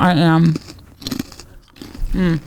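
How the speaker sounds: talking while crunching; chewing crunchy chips. Real, not acted. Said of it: "They can hear me crunching".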